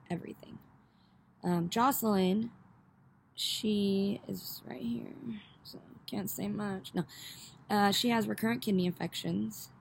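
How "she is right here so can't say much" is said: hushed so she won't hear; whispered, slow aside. Real, not acted. Subject of talk sits beside her.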